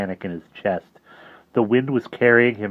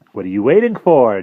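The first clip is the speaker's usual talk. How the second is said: louder pushing challenge; a hint of impatient tempter; 3 semitones higher, 9 dB louder than usual. Jive goads Harvey to attack, impatient and louder. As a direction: goad him on.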